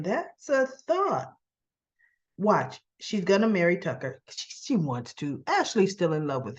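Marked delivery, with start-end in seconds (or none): 0.0-1.3 drawn out and higher, savoring the idea; slow thoughtful musing. Retold or imitated. Ashley mulling over Tucker's proposal, slow and musing. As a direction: let the thought sink in slowly. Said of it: "She's thinking".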